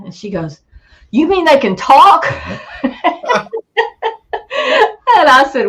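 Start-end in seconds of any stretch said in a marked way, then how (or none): 1.1-2.2 loud, high startled question with a laugh; shocked disbelief. Retold or imitated. Wife realizing the voice mimicked them, laughing shout. 2.3-5.1 high burst of laughter. Real, not acted. Storyteller cracking up at his wife's reaction, laughing.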